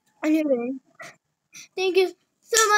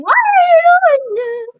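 high, loud disbelieving question; incredulous question; 13 semitones higher, 11 dB louder than usual. Pretend. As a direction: ask it in shocked disbelief. Imagined viewer question.

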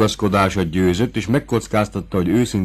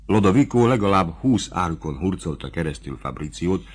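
ordinary delivery

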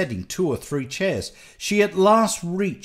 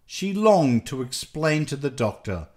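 plain reading voice

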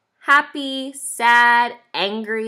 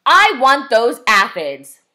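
loud, slow demand from a grouch; storybook grouch shouting; grouchy shout; 8 dB louder, slower than usual. A narrator's reading. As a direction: demand it loudly, stretching the words. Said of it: "shouted the grouchy ladybug".

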